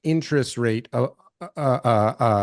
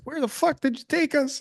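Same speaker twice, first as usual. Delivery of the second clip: angry wife; 12 semitones higher, 4 dB quieter than usual. Retold or imitated. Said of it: "your wife's like".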